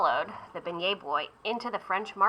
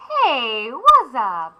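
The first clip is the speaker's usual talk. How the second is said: drummer's voice; 3 semitones higher, 6 dB louder, much slower than usual. Acted. Drummer greets beignet.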